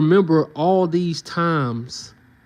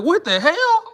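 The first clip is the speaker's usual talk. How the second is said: high incredulous squeal; mock shocked brother; 11 semitones higher than usual. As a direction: spit it out in stunned disbelief. Imagined brother reaction.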